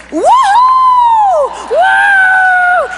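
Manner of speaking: very high, loud drawn-out "woo"; gleeful high holler; excited whoops. Retold or imitated. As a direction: whoop with uncontainable delight. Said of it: "really excited".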